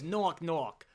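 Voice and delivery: toned-down mock Boston accent at normal volume; bad Boston accent. Retelling the cop's knock-knock joke, mild bad Boston accent. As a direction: do a lighter, badly faked Boston accent. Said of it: "doing a bad impression of someone doing a bad".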